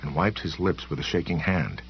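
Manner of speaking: usual speaking voice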